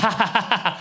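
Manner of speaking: imitated laugh. Comic caught understanding Chinese, mock laughing. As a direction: act out a burst of laughing. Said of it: "which made me laugh".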